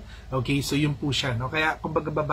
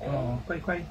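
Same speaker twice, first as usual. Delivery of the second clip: ordinary talk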